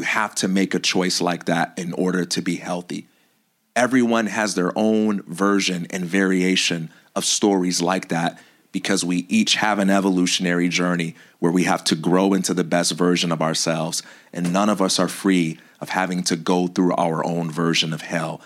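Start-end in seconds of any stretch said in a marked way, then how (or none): none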